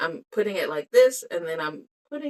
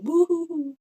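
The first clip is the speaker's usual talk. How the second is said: hooting woo-hoo-hoo way up high; a silly high celebratory hoot; cheering her new earring eyes; playful high woo-hoo; 11 semitones higher, 3 dB louder than usual. Real, not acted.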